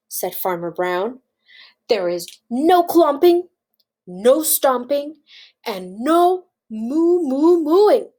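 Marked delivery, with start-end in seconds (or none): 1.9-8.1 louder, slow, emphatic scolding; firm rule-giving. Acted. Farmer forbidding the cows their noises, firm and emphatic. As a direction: punch each forbidden noise.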